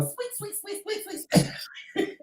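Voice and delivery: tiny, very high, soft squeak; squeaking mouse. Retold or imitated. Storyteller imitating a mouse being tossed about, high soft squeaks. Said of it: "playing with this little mouse was going".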